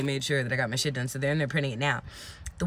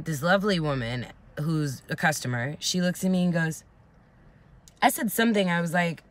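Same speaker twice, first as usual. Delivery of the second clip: ordinary talk, nothing marked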